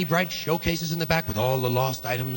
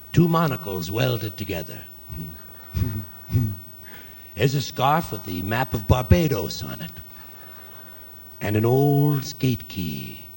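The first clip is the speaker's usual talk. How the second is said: low, slow customer voice; 7 semitones lower than usual. Acted. Customer browsing the drawer.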